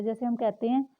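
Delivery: ordinary delivery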